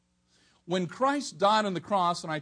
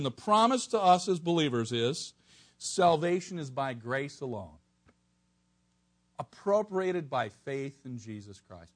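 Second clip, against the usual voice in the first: ordinary delivery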